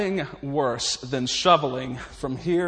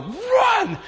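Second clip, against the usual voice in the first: sudden full-throated yell, pitched way up; yelling run; 5 dB louder than usual. Retold or imitated. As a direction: bellow it at the top of your lungs to warn. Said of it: "Grandpa would yell".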